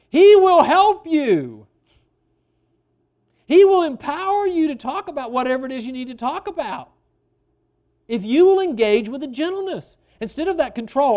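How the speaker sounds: high, loud preacher's proclamation; full-force preaching. Real, not acted. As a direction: ring it out to the whole congregation.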